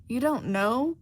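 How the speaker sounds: as if the answer were an insult; put out. A narrator's reading.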